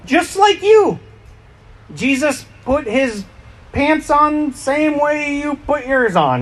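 high-pitched, emphatic preaching. Acted. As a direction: rise high to drive the point. Stresses Jesus was human.

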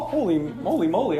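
usual speaking voice

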